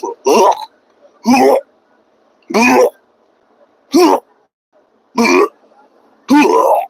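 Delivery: high, loud mock gag sounds; gagging ugh sounds, mocking; mock disgusted retching. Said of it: "They're throwing up in their mouth".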